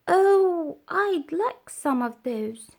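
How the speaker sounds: high, longing oh; a light caterpillar voice full of wishing; wistful caterpillar. Acted. Caterpillar longing for the bee's wings, lightly voiced.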